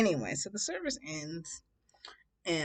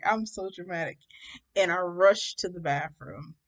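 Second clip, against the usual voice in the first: normal talking voice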